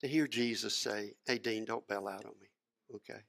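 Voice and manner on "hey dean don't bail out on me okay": very soft, low personal plea; quiet, intimate encouragement; gentle urging. Retold or imitated. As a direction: speak privately, close and hushed. Jesus gently urges the preacher.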